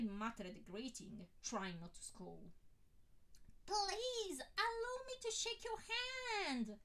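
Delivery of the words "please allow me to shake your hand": high, slow, gushing eager fan voice; a man thrilled to meet his hero, gushing high; eager admirer. A narrator's reading. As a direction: fawn over him, voice way up high.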